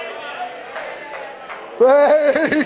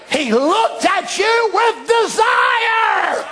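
higher and very slow, lingering on with; drawn-out emphasis; 8 semitones higher, much slower than usual. Acted. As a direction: stretch every word out. Emphatic sermon climax.

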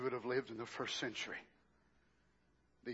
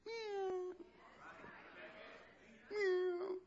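high, soft crying meow; a frightened kitten mewing; whimpering kitten; 8 dB quieter than usual. Pretend. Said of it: "instead of him being like that".

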